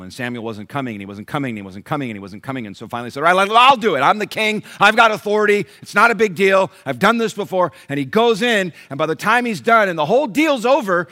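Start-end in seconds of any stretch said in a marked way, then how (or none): 3.2-7.7 loud, high, blustering king; impatient king overriding the rules, loud and high; defiant King Saul. Acted. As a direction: puff up and justify yourself. Saul justifying himself loudly.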